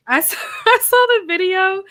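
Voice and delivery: high, loud, laughing through the words; real giggly outburst; laughing while talking. Real, not acted. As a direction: crack up as you start the sentence. Amused recalling a funny video.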